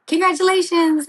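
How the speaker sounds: high, sing-out congratulations; mock congratulations. Friend springing a surprise title win.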